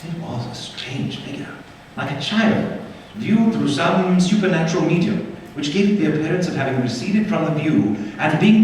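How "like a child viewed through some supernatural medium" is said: ordinary delivery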